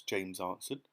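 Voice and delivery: ordinary delivery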